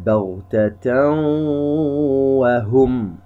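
بغتة وهم chanted slowly as baghtataw-wahum, hummed W; merging with ghunna. Shown as a demonstration. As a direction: hold a nasal W joining بغتة to وهم. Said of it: "إدغام بغنة يغن بمقدار حركتين". (إدغام بغنة في بغتة وهم مرتلة)

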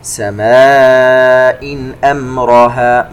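slow, melodic tajweed chant; measured Quranic recitation. Shown as a demonstration. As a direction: recite slowly with held nasal sounds. Recites verse segment.

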